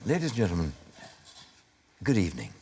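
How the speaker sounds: lightly played airline captain, unhurried pauses; mock cabin announcement, dropping low at the end; reassuring captain. Actor playing pilot to passengers on a flight, reassuring. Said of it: "You are the most reassuring voice a plane passenger could ever hear".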